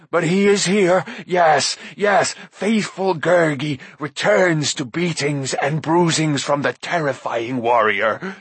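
slow, whiny, groveling beast voice; fawning creature. A narrator's reading. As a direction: grovel to the mighty lords. Gurgi's creature voice.

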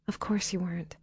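hushed reassurance; soft whisper. A narrator's reading. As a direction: reassure her barely above a whisper. Tender reassurance.

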